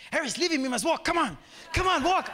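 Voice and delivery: high, charged-up mock command; fired-up urging. Friend ordering the sick man to walk, fired up. Retells Didi fired up after prayer.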